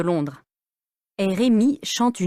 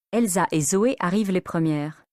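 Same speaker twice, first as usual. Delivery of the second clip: usual speaking voice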